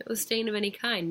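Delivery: ordinary delivery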